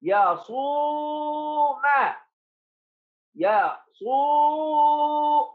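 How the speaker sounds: يصوم very slow, oo held on a high note; high, level, sustained oo in يصوم; long waw. Shown as a demonstration. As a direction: dictate يصوم, stretching the middle oo. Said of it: "مد بالواو".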